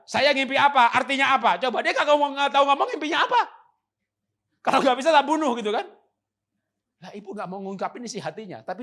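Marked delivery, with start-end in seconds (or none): 0.0-5.9 mock tyrant king. Voicing the king's demand.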